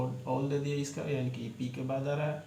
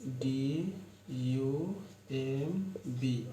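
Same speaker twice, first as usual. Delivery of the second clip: letters read slowly one by one; dictating letters slowly; spelled out slowly; much slower than usual. Shown as a demonstration. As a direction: spell the word slowly for the class. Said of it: "इसी तरह आपका होता है".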